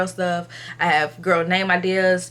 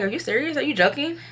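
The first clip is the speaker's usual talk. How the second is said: higher-pitched incredulous question; retold disbelief, pitched up; disbelieving; 8 semitones higher, faster than usual. Asking the tech if she is joking, incredulous.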